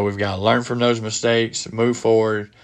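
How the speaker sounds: normal talking voice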